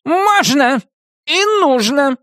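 high, emphatic witch retort; cutting witch. A narrator's reading. Witch overrules Нептолиум's objection, cuttingly. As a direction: cut him off in a high voice. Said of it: "как отрезала".

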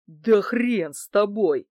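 slow, grudging angry give-in; exasperated anger. A narrator's reading. Thug gives in to his pal, slow exasperated anger. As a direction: throw it out, mildly furious. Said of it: "Разозлённый Герыч швырнул".

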